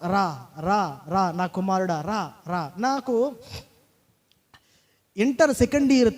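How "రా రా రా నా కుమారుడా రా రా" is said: soft, low, coaxing call to a son; God's tender call. Acted. God softly calling the young man to come. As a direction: call him gently, as a loving father.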